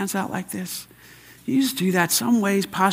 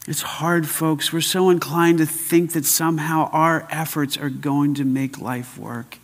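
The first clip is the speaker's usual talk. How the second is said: ordinary delivery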